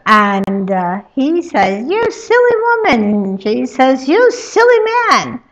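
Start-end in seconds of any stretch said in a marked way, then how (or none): 1.9-3.0 annoyed retort. Acted. Bickering insult. 4.1-5.4 annoyed retort. Acted. Bickering insult.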